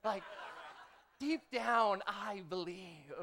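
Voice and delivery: soft, drawn-out mock insistence; a hammed-up plea, slowing on believe; mock protest to God, softly drawn out; mock pleading protest. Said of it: "the dumbest thing ... is be like".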